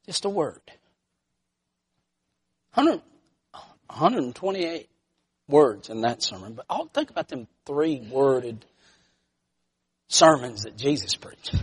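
low, soft and spaced out; hushed preaching. Real, not acted. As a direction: let each phrase sink in quietly. Preacher drops to hushed emphasis.

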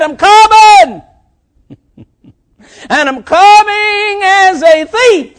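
high, loud ringing proclamation; booming shout. Acted. Preacher voicing Jesus to the congregation, booming shout. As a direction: thunder the warning at full voice.

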